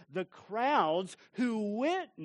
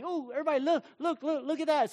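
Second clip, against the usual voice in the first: much higher and louder, urgent; calling out; 10 semitones higher, 6 dB louder, much faster than usual. Retold or imitated. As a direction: grab the kids' attention at something outside. Parent calling kids in car.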